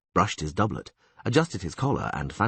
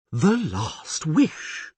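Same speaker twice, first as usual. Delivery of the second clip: awed repetition; 6 semitones higher, much slower than usual. Acted. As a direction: say "The last wish" again slowly, hushed and amazed. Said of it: "repeated the priest".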